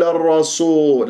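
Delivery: الرسول with sin thickened into a sad; wrongly heavy sin. Shown as a demonstration. Teacher shows students what to avoid, sad-like الرسول. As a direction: darken the s in الرسول to emphatic. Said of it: "لا أفخم السين".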